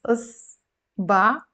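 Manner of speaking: إصبع split slowly into "us", pause, "ba"; 分音节慢速示范发音. Shown as a demonstration. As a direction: hold the s in إصبع, then land on ba. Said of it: "So this one is".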